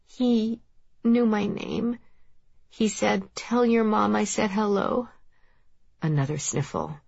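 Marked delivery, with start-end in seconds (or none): 0.0-5.1 put-on high, small child's voice; hesitant young boy, pitched well up; upset little boy. A narrator's reading. Boy reports a stranger's words to his mother, child voice.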